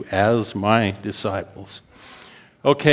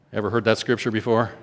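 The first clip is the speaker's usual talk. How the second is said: ordinary talk, nothing marked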